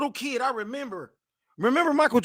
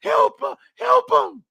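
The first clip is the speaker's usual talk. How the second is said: put-on pleading little boy; 3 semitones higher, 8 dB louder, slower than usual. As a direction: beg mama like a crying child. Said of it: "I'm looking at my mama".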